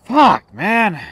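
frustrated curse. Real, not acted. Gamer frustrated by his slow progress, loud curse. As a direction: spit out the frustration.

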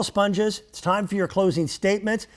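usual speaking voice

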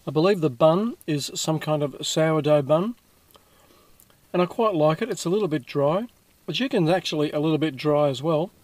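talking with food in mouth; speaking between bites of burger; chewing while talking. Real, not acted. Seen 1: Bit burger at -4s; mouth still working while talking, burger held.

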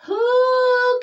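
high, loud gasp of recognition. A narrator's reading. Douglas recognising someone in the cave, gasping. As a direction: let out a drawn, astonished cry. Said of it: "gasped Douglas".

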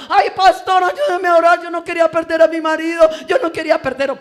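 high, very loud imitated wailing; hammed-up tearful lament; mock crying. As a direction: plead with the pastor like a distraught wife. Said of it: "Y después está llorando".

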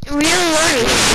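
very loud, drawn-out yell; loud shout. Real, not acted. Correcting a friend who misheard, shouting. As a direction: shout it slowly so it finally gets through.

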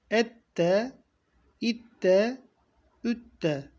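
Ette with a held double t; doubled t drill. Shown as a demonstration. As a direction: hit the t twice in Ette. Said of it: "telaffuzlara dikkat edin".

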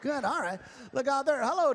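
ordinary talk, nothing marked

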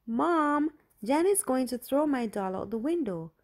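put-on a little girl begging mom to save her doll; frantic. A narrator's reading. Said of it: "Julie went crazy".

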